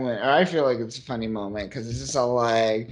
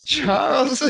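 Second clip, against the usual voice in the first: mock yell; 13 semitones higher, 5 dB louder than usual. Echoing the mom's yell.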